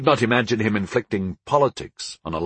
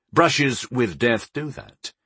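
plain reading voice

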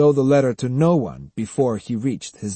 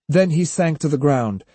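ordinary delivery

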